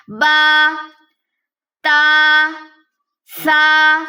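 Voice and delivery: با, تا, سا each said slowly and clearly; teacher's model recitation; letter names recited. Shown as a demonstration. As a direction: recite با تا سا one by one. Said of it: "با کے نیچے ایک نقطہ ہے".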